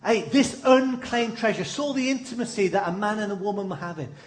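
ordinary talk, nothing marked

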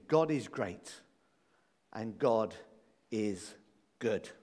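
God is great and God is good with long pauses between the words, each dropped low; slow, deliberate quote. Shown as a demonstration. Preacher quoting Sproul, slow and deliberate. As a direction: spell out the definition word by word. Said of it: "I like R C Sproul's definition".

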